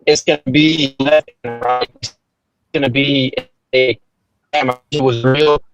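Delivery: choppy, cutting-out call audio; bad connection, words dropping out; radio breaking up. Real, not acted. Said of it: "you're breaking up a bit".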